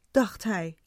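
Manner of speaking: ordinary delivery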